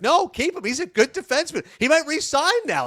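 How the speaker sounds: high-pitched, emphatic retold argument; insistent objection. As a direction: protest as if defending a player to doubters. Retold argument against trading player.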